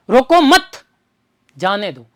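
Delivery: emphatic मत with a falling-away ending; stress on मत. Shown as a demonstration. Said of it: "अतिरिक्त जोर दिया मैंने".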